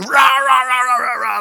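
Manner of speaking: loud high gibberish yelling rah rah; hammed-up angry family squabble; mock angry ranting. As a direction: rant nonsense like a car fight. Mock fighting in the car.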